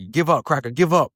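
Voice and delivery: mocking taunt. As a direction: jeer it at the man on the floor. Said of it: "They're like".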